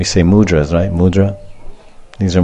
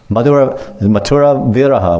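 usual speaking voice

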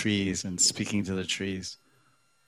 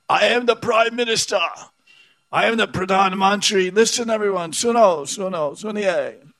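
put-on high, loud, wild drunken proclamation; drunken shout; 12 semitones higher, 7 dB louder than usual. Drunk man shouting at everyone in Connaught Place, high loud bellow. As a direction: bellow it like a drunk claiming the street.

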